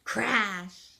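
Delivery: usual speaking voice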